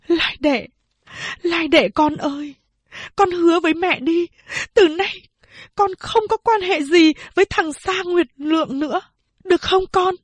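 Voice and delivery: high, imploring mother's plea; begging. A narrator's reading. Mother begging daughter to give up the suitor, high and imploring. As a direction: plead with her, almost in tears. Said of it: "van nài".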